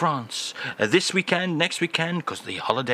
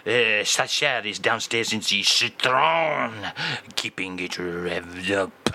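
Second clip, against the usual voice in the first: mock Aznavour; 5 semitones lower than usual. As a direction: murmur it low like a French lover. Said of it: "[name]".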